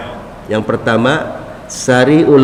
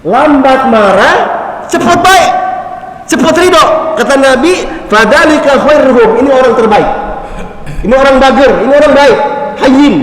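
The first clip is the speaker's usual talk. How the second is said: higher and louder, fervent preacher build; preaching at full force; 12 semitones higher, 6 dB louder than usual. Real, not acted. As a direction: drive the point home with rising fire.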